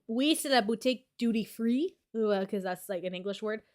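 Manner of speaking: shop phrases in Quebec French; casual Quebec French accent. Shown as a demonstration. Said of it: "So that's my accent".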